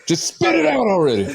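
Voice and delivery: high laughing jab; mock impatience. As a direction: squeal it impatiently. Mocking, much higher with laugh.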